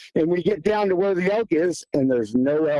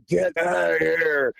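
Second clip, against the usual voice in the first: drawn-out, slurred creature holler; mock monster roar at talking volume; imitated monstrous yell; slower than usual. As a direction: stretch the words into one long monster cry. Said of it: "yelling the most monstrous sound".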